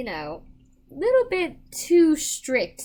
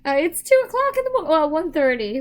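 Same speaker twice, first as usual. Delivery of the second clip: mock outrage; 5 semitones higher, 8 dB louder than usual. Real, not acted. Joking exaggeration about the hour.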